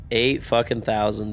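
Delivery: ordinary talk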